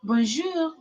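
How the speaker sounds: high, lilting French greeting; French accent. Shown as a demonstration. Showing Kitty Mum the accent, high French greeting. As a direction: sing out the greeting with French vowels. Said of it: "Kitty Mum, the accent".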